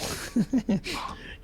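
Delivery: light, breathy chuckle; real soft laugh; amused laugh. Real, not acted. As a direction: chuckle quietly at your own joke. Laughs at own joke.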